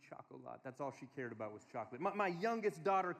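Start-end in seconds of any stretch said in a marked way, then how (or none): 0.1-0.6 "chocolat" said low and soft as choc-o-lah; quiet childish "chocolat"; little girl's pronunciation. Retold or imitated. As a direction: imitate a toddler asking, with "chocolat" ending in "lah". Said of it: "She would say".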